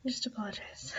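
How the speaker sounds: soft whisper; quiet at night. Real, not acted. Whispering, parents asleep.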